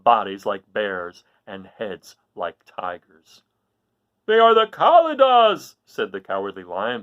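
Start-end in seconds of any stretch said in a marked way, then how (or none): 4.3-5.7 high, loud, slowed alarm; frightened cry. Acted. The Cowardly Lion warns the others, frightened. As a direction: cry out as the beasts appear. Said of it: "beginning to tremble".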